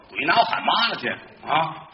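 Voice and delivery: ordinary talk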